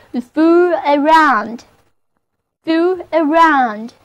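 slow model reading. Shown as a demonstration. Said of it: "词组fool around".

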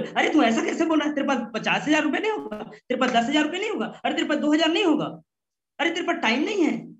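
high, mocking and scornful, fast in the middle; ego-hurting rude talk. Shown as a demonstration. Trainer shows what not to tell a prospect: high, scornful taunting. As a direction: belittle the customer, sneering at his money. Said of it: "इस तरह की ego hurt वाली बात नहीं बोलना".

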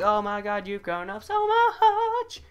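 high, slow doting parent voice; gushing parent. Retold or imitated. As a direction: coo like an amazed parent. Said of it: "they're gonna be like".

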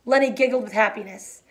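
plain reading voice

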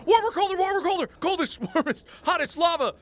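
high, loud chorus of calls; squealed imitation of excited chat; mock clamoring viewers. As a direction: shout contradictory hints in a frenzy. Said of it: "all that's gonna happen is".

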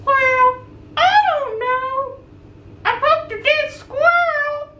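high, slow puppet voice. Pretend. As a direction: play a cheerful little puppet.

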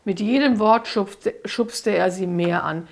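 usual speaking voice

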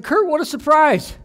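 pitched well up, playing it cheerful to hide tears; retold cover-up, brightly surprised; faked cheerful surprise. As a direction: sound pleasantly surprised while covering crying. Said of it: "sound like I'm not sitting there crying".

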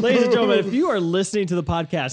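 high-pitched excited announcer call; playful announcer. Pretend. Host addressing podcast listeners about the feat, playful announcer. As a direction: announce it to the whole audience.

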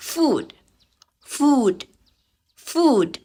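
"food" with long oo, high then falling; model pronunciation. Shown as a demonstration. Teacher models "food" for students, model pronunciation. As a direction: pronounce "food" with a long vowel and final d. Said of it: "ฝึกอ่านออกเสียงสามคำนี้".

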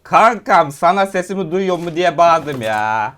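loud, high, drawn-out protest to a pal; loud exclamation. Real, not acted. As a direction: call it out loudly, stretching the words. Exclaims to teammate after laughing.

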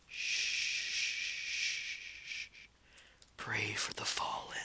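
low, slow hushed comfort with long shushes; creepy soothing whisper. Pretend. Killer comforting a dying victim, creepy whisper. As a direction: lull them softly into darkness.

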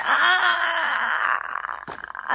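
mock dismayed cry. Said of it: "って言ってたら".

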